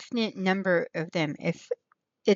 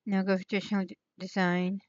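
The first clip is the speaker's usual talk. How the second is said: ordinary delivery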